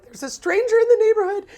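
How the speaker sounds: high, loud mock-alarmed quote; mock panic. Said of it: "they go on Facebook and they're like".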